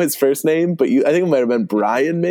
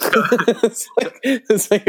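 laughing; 9 semitones higher, much slower than usual. Real, not acted. Laughing at the interview quote.

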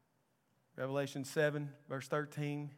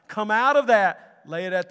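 forceful exhortation; 7 semitones higher, 15 dB louder than usual. Real, not acted. Preacher calling the congregation out of pride, forceful exhortation. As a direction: urge it with a sudden surge of force.

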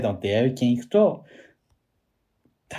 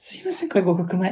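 high, bright service voice; lightly voiced polite waiter; polite clerk; 10 semitones higher, 4 dB louder than usual. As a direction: play the clerk bringing the rice. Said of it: "って出てきて".

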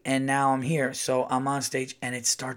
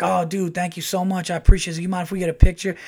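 excited; 5 semitones higher, 6 dB louder than usual. Retold or imitated. Greeting fans happily.

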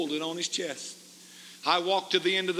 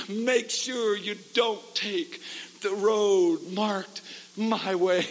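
ordinary talk

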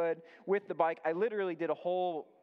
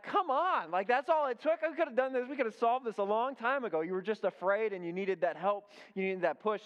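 excited exclamation; 6 semitones higher than usual. Retold or imitated. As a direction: cry it out like a dad who can't believe it was that easy. Dad's relieved outburst retold.

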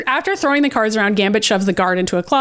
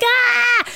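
high-pitched yelp of fright; mock startled scream; 14 semitones higher than usual. Guard jumping at a moving statue, mock startled scream.

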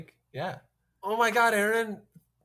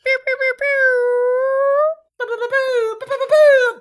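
high pew sound effects; 11 dB louder than usual. Acted.

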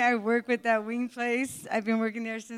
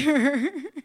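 nervous laugh; 5 semitones higher, 6 dB louder than usual. Real, not acted. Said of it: "This is my first time on a stage".

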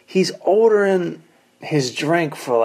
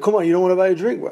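fast, higher, pushy coaxing; pushy guy; 5 semitones higher, much faster than usual. Retold or imitated. Car dealer pressing comics to take his drink, fast and pushy. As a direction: push hard to buy them a drink.

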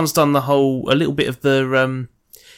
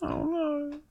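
high, soft falsetto "Oh no"; mock fright; 9 dB quieter than usual. Friend faking horror at intruder, soft high squeak.

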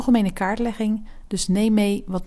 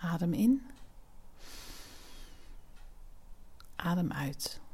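soft spa whisper; 5 dB quieter than usual. Acted. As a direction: whisper them through each breath. Guided breathing exercise.

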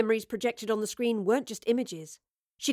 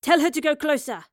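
raised-pitch, urgent call; announcing; 5 semitones higher, 5 dB louder than usual. A narrator's reading. Scavenger urging the gang on at the landslide, announcing. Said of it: "one of the scavengers announced".

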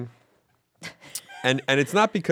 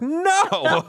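yelped high no; high squeaky no; 4 dB louder than usual. Retold or imitated. Blunt answer to wife.

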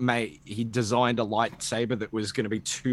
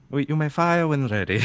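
Tarkin impression; 5 semitones higher than usual. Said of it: "That slipped into Tarkin".

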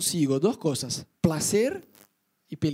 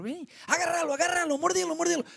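much higher mock sic-him call; mock dog command; 10 semitones higher than usual. Friend shouting at dog. (orden al perro, mucho más aguda)

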